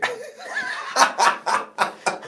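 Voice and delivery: high breathy laugh. Real, not acted. Laughing at own joke.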